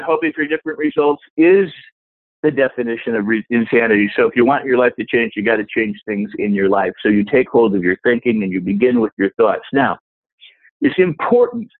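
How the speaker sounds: usual speaking voice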